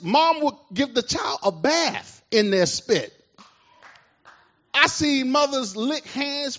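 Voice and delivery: preacher rousing the church with a shared memory, fervent; preaching at full force. Acted. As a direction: whip up the congregation.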